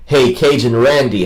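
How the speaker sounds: ordinary delivery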